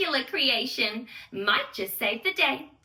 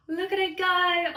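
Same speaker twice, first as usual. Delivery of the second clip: amazed gasp; 5 semitones higher than usual. Acted. Narrator marvels at the launch.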